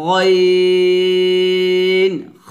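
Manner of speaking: গইন খ drawn long, thick and loud; heavy Arabic ghain kha. Shown as a demonstration. Teacher demonstrating the heavy throat letters to students. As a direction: stretch গইন খ heavily from the throat. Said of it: "মোটা করতে হবে".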